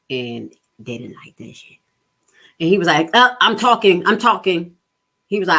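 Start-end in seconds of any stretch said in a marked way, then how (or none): 3.1-4.8 louder firm cutoff; louder authoritative judge; mock stern interruption. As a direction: talk over her, firm.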